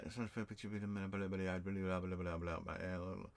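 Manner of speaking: low, quiet monotone babble; skimming mumble. Real, not acted. Vlogger skimming through viewer questions, low mumbled blahs.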